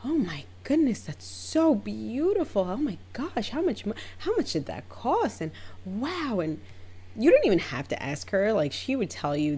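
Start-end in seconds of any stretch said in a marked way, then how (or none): 0.0-6.4 high, breathy mock awe; fawning gush. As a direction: imitate stylists gushing over a rich client. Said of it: "were feeding into it".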